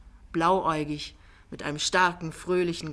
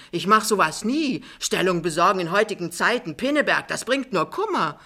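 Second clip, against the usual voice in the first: higher, louder protest; breezily denying Jachmann; 3 semitones higher, 6 dB louder than usual. Acted. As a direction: wave the charge away emphatically. Jachmann streitet alles ab. (lebhaft und laut abwehrend)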